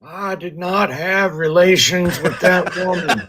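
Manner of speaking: high put-on voice of a denying politician; politician impression. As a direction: play a politician lying with a straight face. Mocking the Clintons' denial.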